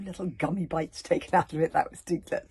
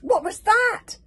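high, loud startled question; alarmed cry; 12 dB louder, slower than usual. Acted. Grabbit the Grim, startled by the knocking, cries out. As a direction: yelp it out in alarm. Repeat, louder and higher.

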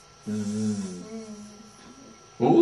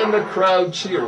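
mock crowd cheer; 8 semitones higher than usual. Mock crowd after a basket.

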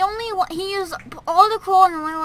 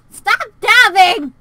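sharp yell; 7 semitones higher, 10 dB louder, slower than usual. Real, not acted. Scolding someone off mic.

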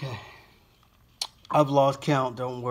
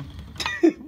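high giggle. Real, not acted. Laughing after the stunt.

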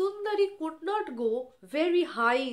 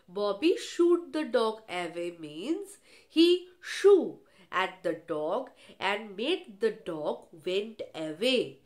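ordinary delivery

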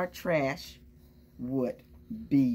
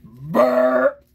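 loud burp; 7 semitones higher, 12 dB louder than usual. Acted. Truck burps after eating.

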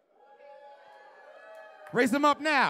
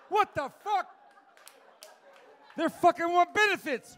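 much higher, alarmed outburst; hammed-up shocked yell; mock panic; 8 semitones higher, 3 dB louder than usual. Comic playing a panicked crowd at Pearl Harbor, mock alarm. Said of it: "Pretend like Pearl Harbor just got bombed".

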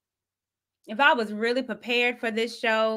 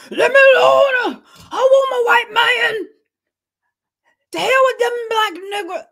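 high, loud, shrill mock scream; mock screaming woman; 11 semitones higher, 10 dB louder than usual. Host imitating a screaming guest's loud outburst. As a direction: scream it like she did. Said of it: "That woman that just was screaming".

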